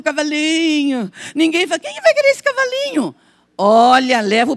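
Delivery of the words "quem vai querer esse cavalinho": mocking doubter.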